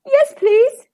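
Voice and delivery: high, tearful acceptance; loud, high, weepy little reply; sniffly. A narrator's reading. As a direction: sniff back tears and accept. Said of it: "he sniffed".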